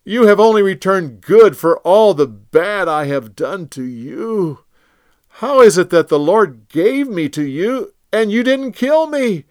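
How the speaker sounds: a touch of crying in a loud plea; broken king begging mercy; tearful. Acted. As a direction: Saul weeping as he begs David. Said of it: "he broke down and cried".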